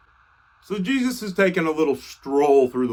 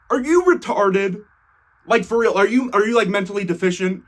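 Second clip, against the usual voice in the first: exasperated, higher-pitched disbelief; mock-incredulous outburst, pitched up; finally snapping; 4 semitones higher than usual. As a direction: act out someone at the end of their patience. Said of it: "This is when Jesus finally snaps".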